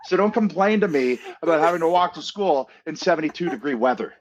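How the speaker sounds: high, hammed-up get-off-my-lawn rant; mock grumpy old-timer. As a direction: play the crusty elder bragging about hardship. Mock rant about hard childhood.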